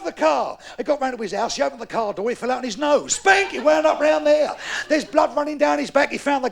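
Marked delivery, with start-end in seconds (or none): 3.1-3.5 high shouted Spank; comic impact yell. Retold or imitated. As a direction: shout the smack like a sound effect.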